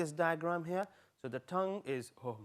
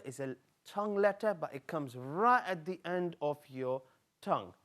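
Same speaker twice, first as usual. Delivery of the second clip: ordinary delivery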